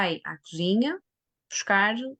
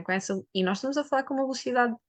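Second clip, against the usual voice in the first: fast talking demo; 3 semitones higher, faster than usual. Shown as a demonstration. Demonstrating rushed speech to listeners, fast. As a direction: rattle it off fast, like fine print. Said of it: "como se nós estivéssemos a falar assim".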